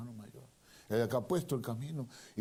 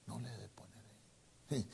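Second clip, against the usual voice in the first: whisper; 10 dB quieter than usual. Real, not acted. Aside to the congregation.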